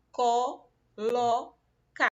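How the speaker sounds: "Colocar" drawn out very slowly as co-lo-car; syllables shown. Shown as a demonstration. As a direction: stretch "Colocar" beat by beat. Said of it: "abriu a boca três vezes pra falar". ("Colocar" bem devagar, sílaba por sílaba)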